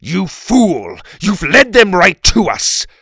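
loud, high, furious shout; enraged king's outburst. A narrator's reading. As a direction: roar the accusation at him. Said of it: "The king looked at Oliver furious".

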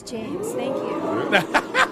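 drawn-out thanks through laughter; laughing thanks. Real, not acted. Thanking James for the anniversary gift, laughing.

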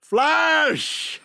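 high, drawn-out mock shout; film-hero cry. Quoting a beloved space movie, hammed-up shout. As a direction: cry it out like a battle call.

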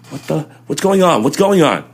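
high, alarmed questions; half-awake panic at the door; retold confusion. Waking to a raid, asking what is happening, high and alarmed.

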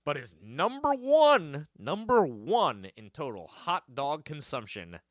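slow, emphatic reveal; dramatic build. Acted. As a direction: drag out number one dramatically.

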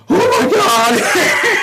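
high, long-drawn mock horror with laughter; hammed-up gasping shout, stretched slowly; retold shock. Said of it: "he looks over and he goes".

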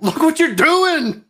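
high, slow mock scolding. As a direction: whine it like a dismayed sender. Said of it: "the guy ... is like".

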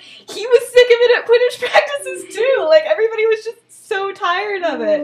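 high, loud, laughing justification; exasperated explaining. Retold or imitated. Pranksters explain themselves to Remus, high-pitched exasperation. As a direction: imitate a fed-up prankster bursting to explain.

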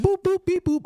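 high, loud robot beeping; robot beeps. Retold or imitated. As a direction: beep like a toy robot. Imitating a sex robot.

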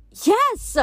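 very high, loud cry; piercing demon shriek. A narrator's reading. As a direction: shriek in triumphant agreement. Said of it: "Seraphine shrieked".

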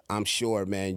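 ordinary talk, nothing marked

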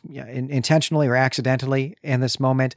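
usual speaking voice

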